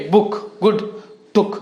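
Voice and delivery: "बुक, गुड, टुक" with brief vowels; short OO. Shown as a demonstration. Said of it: "मुख्तसर यानी short". ("बुक, गुड, टुक" में छोटा ऊ)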